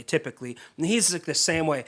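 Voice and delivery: ordinary delivery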